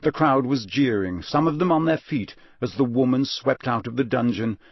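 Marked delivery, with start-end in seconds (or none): none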